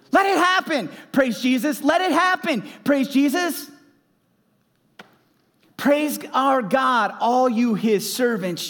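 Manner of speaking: fervent sermon climax; preaching at full force. Acted. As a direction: shout the praise like a revival preacher.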